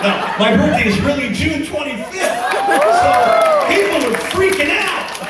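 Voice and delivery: laughing loudly through the words; can't stop laughing while telling it. Real, not acted. Laughing at his own joke.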